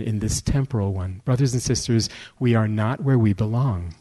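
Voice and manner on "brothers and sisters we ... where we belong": ordinary talk, nothing marked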